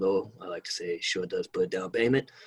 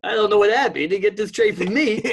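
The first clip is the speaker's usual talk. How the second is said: laughing while talking, high and loud; 11 semitones higher, 8 dB louder, slower than usual. Real, not acted. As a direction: giggle through the words. Laughing through denial.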